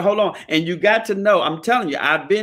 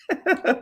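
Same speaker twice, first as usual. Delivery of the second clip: high laugh; 10 semitones higher, 4 dB quieter than usual. Real, not acted. Laughing at own joke.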